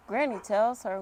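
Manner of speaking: usual speaking voice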